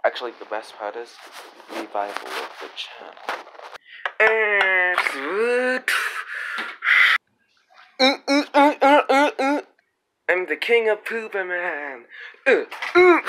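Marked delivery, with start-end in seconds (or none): 4.2-13.2 silly high cartoon voice. Silly high character voices, trailer.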